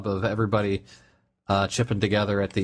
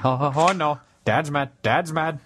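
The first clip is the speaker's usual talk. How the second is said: higher, louder comic alarm; mock panicked dogs; 6 semitones higher, 3 dB louder than usual. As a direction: play scared dogs warning each other. Voices dogs fleeing the noisy gun.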